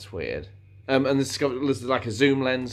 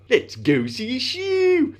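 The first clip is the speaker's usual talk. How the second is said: high, loud, drawn-out silly voice; 14 semitones higher, 5 dB louder, slower than usual. Pretend. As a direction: squash the vowels and send the last word sky-high. Repeats the silly catchphrase.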